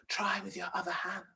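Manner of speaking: soft, breathy, hissing snake voice begging for help; a tiny frightened snake calling out in a whisper. Acted. Small snake begging the princess to hide it, hushed whisper.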